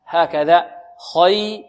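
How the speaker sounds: normal talking voice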